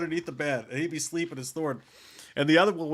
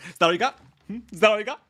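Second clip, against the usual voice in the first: defiant taunt; 5 semitones higher, 6 dB louder, faster than usual. Retold or imitated. Spanked boy taunts his mother.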